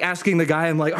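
ordinary delivery, nothing marked

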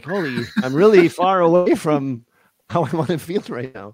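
slow, loud startled self-talk; a touch of alarmed surprise; mock realization. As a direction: voice the shock of noticing.

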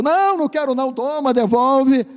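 high, flustered voice pushing the gift back; panicked refusal. Acted. Passageiro recusa o necessaire. (recusa aflita, voz muito aguda)

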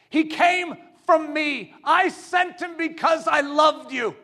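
high, loud shout from the pulpit; preaching shout. Acted. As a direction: declare it with full force. Voicing God, full force.

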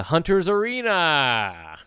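slow, drawn-out game title; dramatic title announcement. Acted. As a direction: announce it like a trailer voiceover.

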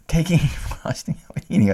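laughing while talking. Real, not acted. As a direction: giggle through the words. Laughing at frosting eaters.

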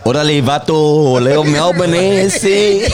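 loud, hammed-up Mexican accent; joking Mexican accent, played big; mock Mexican accent. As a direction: put on a cartoon Mexican greeting. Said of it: "them filthy Mexicans".

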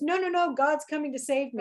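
the man waving off the rescue, insisting; stubborn insistence. Retold or imitated. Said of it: "he goes".

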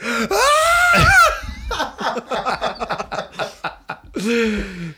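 loud, high shouting laugh; roaring laugh. Real, not acted. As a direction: crack up at the memory. Laughing at payback story.

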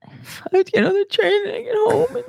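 high fake blubbering; mock crying whine. Host mocking the commuter girl, fake crying. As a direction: sob it out like a spoiled crybaby.

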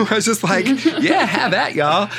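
high, laughing through the line. Real, not acted. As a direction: giggle out the words up high.

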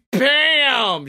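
usual speaking voice

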